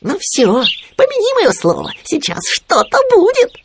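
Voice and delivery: very high, squeaky aside; Khryunya, mock whisper. A narrator's reading. Khryunya warning Winnie about Owl, high mock whisper. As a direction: warn in a high stage-whisper imitation. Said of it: "шёпотом проговорил Хрюня".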